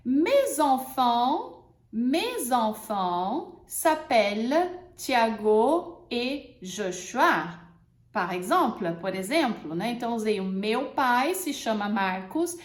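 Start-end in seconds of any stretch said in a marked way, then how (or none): 0.1-7.6 very slow, clear example; teacher models slowly; slow model sentence. Shown as a demonstration. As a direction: stretch every word. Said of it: "vamos usar os exemplos agora".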